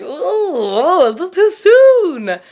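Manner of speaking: high, slow, drawn-out mock wince; hammed-up awkward reaction; mock awkward James. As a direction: squeak it out like he's cringing at the confession. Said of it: "James is probably like".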